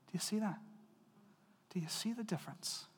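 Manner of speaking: whispered question; preacher whispering to the congregation. Real, not acted. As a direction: lean in and whisper it.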